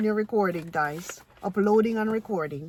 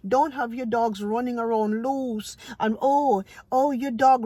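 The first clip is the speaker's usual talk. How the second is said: ordinary talk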